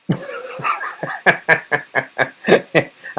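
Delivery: airy wheezing laugh; a long silent wheeze of a laugh; breathless laugh. Real, not acted. Laughing at his own Twinkie story, breathless wheezing laugh.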